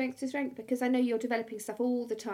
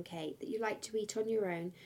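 ordinary talk, nothing marked

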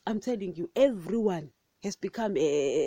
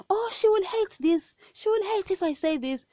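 much higher, hammed-up fawning preacher; mock pastor voice; 12 semitones higher than usual. Mocking a pastor who fears she'll hate the sermon, high and put-on. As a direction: mimic a pastor pandering to her. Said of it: "churches were never meant to look at me and say".

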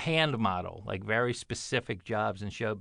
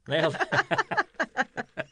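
slow drawn-out "nailed it"; 11 semitones higher, much slower than usual. Real, not acted. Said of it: "drawn-out joke".

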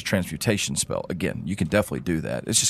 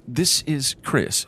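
ordinary delivery

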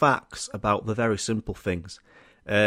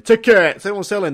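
ticket tout shout; 7 semitones higher, 7 dB louder than usual. Retold or imitated. Touts calling outside venue.

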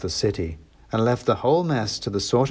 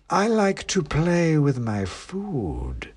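snooty bored cat; 7 semitones higher, much slower than usual. A narrator's reading. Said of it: "came the voice".

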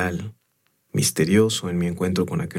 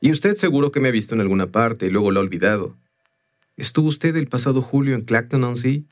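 higher, insistent searching for an explanation; lightly voiced stonemason; 5 semitones higher than usual. Stonemason seeking a rational explanation from the visitor, higher and insistent. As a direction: press the visitor with hopeful questions.

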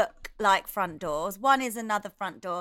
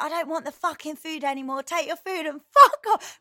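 retold furious yell, rising to the end; telling the delivery man where to go; angry rant; 5 semitones higher, 4 dB louder than usual. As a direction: snap at him to get lost. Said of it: "I went so mental".

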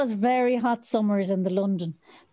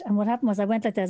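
ordinary talk, nothing marked

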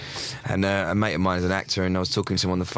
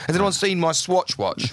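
high, slowish lofty "Has anyone seen my Swatch watch?"; same posh question, now about the "Swatch watch"; hammed-up posh query, "Swatch watch" swapped in; mock toff asker; 8 semitones higher than usual. Corrected version of the joke.

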